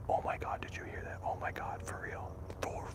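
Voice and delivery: barely voiced, real alarm; hushed panic, afraid of being heard; frightened whisper. Real, not acted. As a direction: whisper it, spooked by a sudden noise. Startled by noise, hiding in cemetery.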